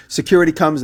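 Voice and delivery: usual speaking voice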